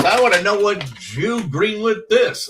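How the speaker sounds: mock comic's voice. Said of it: "he goes".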